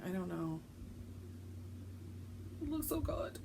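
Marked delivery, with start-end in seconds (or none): 2.6-3.4 high, soft admiration; hushed delight; soft adoring. Real, not acted. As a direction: coo over the piece. Admiring her stitching.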